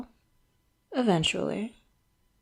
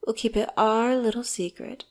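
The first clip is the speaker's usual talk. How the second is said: ordinary talk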